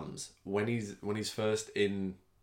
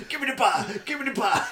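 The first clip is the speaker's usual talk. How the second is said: much higher, loud screaming demand; hammed-up furious yell; mock raging Bronson; 8 dB louder, faster than usual. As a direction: bellow it like an unhinged prisoner. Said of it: "he just starts going".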